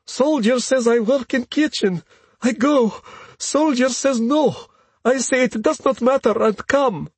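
high-pitched Russian woman, broken English; breathless hurried explanation. A narrator's reading. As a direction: explain yourself urgently in a high, accented voice. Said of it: "out of breath and says hurriedly".

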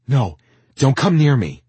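loud, high, agitated warning. Acted. Lizzie backs away from Tristan, loud and agitated. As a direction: fend someone off sharply.

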